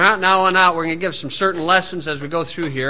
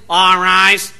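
loud courtroom call, imitated; imitated announcement to the court; rather loud; 4 dB louder than usual. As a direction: call it out like a bailiff, moderately loud. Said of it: "says rather loudly".

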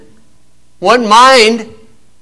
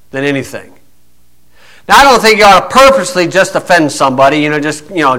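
usual speaking voice

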